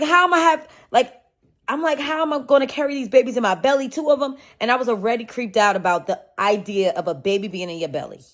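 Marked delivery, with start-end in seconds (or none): 0.0-4.4 high, loud, frantic retelling of the panic; a mock-panicked wail at the thought of twins; panicked worry. First-time mom facing twins, in frantic panic. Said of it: "so scared, nervous".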